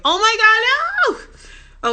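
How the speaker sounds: loud, high, drawn-out squeal; real shocked protest. Real, not acted. Reacting to doing the interview in Spanish, shocked protest. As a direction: squeal in horrified disbelief.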